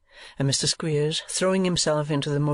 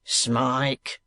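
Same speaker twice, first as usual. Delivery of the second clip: put-on calling the boy's name, understated; a hint of a summoning yell; understated shout up the stairs; mild narrated shout. A narrator's reading. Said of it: "shouted Squeers".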